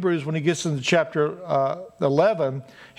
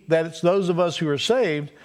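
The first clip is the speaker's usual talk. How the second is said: ordinary talk, nothing marked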